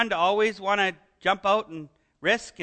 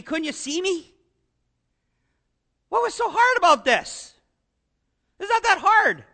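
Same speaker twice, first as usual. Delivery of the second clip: rising higher, exasperated scold; exasperated parent scolding; 4 semitones higher than usual. Pretend. Parent scolding a child who doubted, exasperated and climbing higher. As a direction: nag like an impatient parent. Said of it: "would have said".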